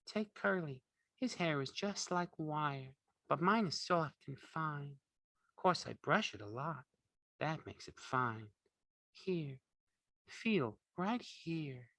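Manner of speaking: soft, high, slow woman's voice; complacent. A narrator's reading. Curly's wife boasts of her soft hair, complacent. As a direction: preen softly and slowly, pitched high. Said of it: "she said complacently".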